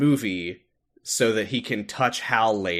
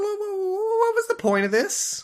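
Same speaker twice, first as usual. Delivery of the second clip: surprised. Pretend. Mock alarm at odd scene.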